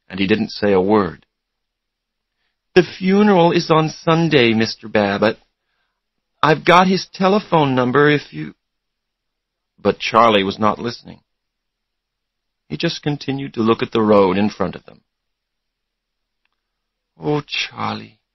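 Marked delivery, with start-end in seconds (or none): 2.7-8.5 put-on higher-pitched girl caller; a girl's voice, pitched up. A narrator's reading. Caller giving funeral details to Charlie, girl's voice. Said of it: "It was a girl's voice". 17.2-18.2 slow, softened voice; soft. A narrator's reading. Susanna to bereaved boyfriend, softly. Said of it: "Susanna said softly".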